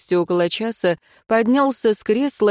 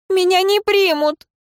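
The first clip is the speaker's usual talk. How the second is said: put-on distressed young woman; 13 semitones higher, 4 dB louder than usual. A narrator's reading. Ireyn protesting in distress.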